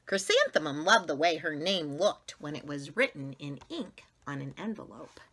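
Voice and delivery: usual speaking voice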